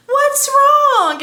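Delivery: very high, loud imitated concern; concerned doctor. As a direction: coo it like a tiny worried doctor. Acting out the concerned tiny doctor.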